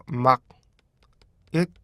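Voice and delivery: 막 it said as separate clipped beats; broken syllables. Shown as a demonstration. Teacher splits the word into syllables for students, slowly. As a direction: chop might into stop sounds. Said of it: "이걸 어떻게 읽어요".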